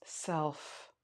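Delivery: quiet meditation guide, long pauses between prompts; guided meditation. Acted. Yoga teacher guiding students to notice their breath, guided meditation. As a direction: lead them softly inward, leaving space.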